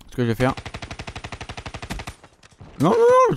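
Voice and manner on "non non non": shrill, much higher pleading no; mock panic. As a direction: beg the enemy not to shoot. Pleading with an enemy player.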